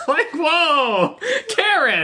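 high-pitched, laughing disbelief; playfully scandalized, voice leaping up; mock shock. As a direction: laugh through a shocked reproach. Mock shock at an imagined cruel line.

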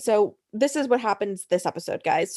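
ordinary talk, nothing marked

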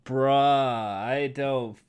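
low, soft, very slow California surfer dude; California surfer accent. Acted. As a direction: drag it out like a stoned California surfer. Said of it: "the stereotypical California accent".

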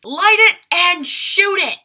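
high, loud, slow producer yell; barked order. Retold or imitated. Gustavo yelling orders at the crew, loud and high. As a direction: bellow the command like an angry boss. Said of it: "And he goes".

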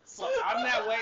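high, laughing speech; cracking up mid-sentence. Real, not acted. Laughing while agreeing.

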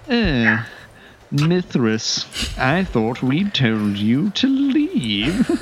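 a light put-on snooty guard; snide guard captain. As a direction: look down your nose at the thief, drawn out. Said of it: "Nailed that voice".

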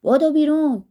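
loud, high urgent shout; hoarse, rough shout. A narrator's reading. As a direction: shout the order to get out. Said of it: "با صدایی گرفته و خشن داد زد".

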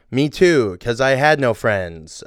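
usual speaking voice

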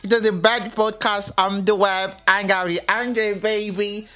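high, fast, pumped-up host intro; radio announcer hype. Acted. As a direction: hype the listeners like a DJ.

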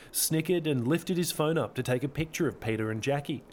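plain reading voice

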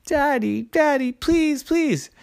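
put-on high little-girl begging; pleading child. A narrator's reading. Child begs daddy in story.

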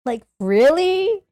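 high, slow drawn-out disbelief; incredulous. Retold or imitated. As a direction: stretch it in disbelief. Mocking Destroyer's cowardice.

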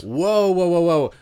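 high, loud whoa whoa; mock backing off. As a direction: hold him off up high. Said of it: "I'm like".

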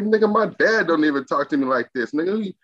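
retold defiance, pitched high; high-pitched mock indignation at the chef; indignant retort. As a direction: tell him straight he can't talk to you like that. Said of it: "I'm like".

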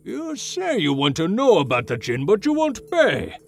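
put-on high, furious old shopkeeper yelling; angry old shopkeeper. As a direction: throw him out of the shop. Shopkeeper throws customer out.

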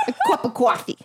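New York accent. Shown as a demonstration. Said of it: "that probably wasn't good".

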